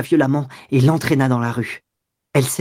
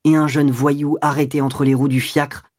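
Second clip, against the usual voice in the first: usual speaking voice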